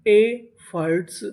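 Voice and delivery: Efforts slowly split, first part held; syllable by syllable. Shown as a demonstration. Teacher sounds out a new word, efforts slowly in pieces. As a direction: break efforts into two drawn-out pieces. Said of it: "efforts का मतलब होता है".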